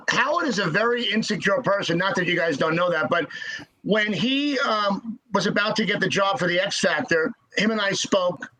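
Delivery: usual speaking voice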